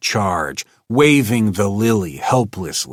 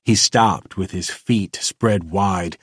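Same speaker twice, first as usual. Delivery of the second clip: ordinary delivery, nothing marked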